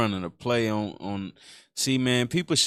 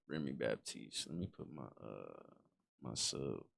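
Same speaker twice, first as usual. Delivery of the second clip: low, very soft mutter to self; absent-minded, barely audible aside; quiet aside; 9 semitones lower, 18 dB quieter than usual. Real, not acted. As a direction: murmur it off-mic while busy with something else. Streamer's aside while fiddling with subs.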